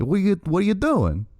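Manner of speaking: high mock Boston accent that comes out Italian; botched Boston accent. Co-host mimics locals at the wrestling show, botched Boston accent. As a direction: put on a bad, Sopranos-style Boston accent. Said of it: "everyone speaking with a Boston accent".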